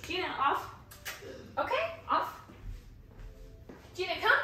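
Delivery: sharp, high dog commands; commanding a dog. Real, not acted. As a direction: call the dog off firmly.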